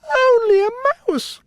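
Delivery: very high squeaky mouse, offended; tiny queen taking offence; indignant mouse cry. A narrator's reading. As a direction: squeak in wounded pride. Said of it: "cried the little animal".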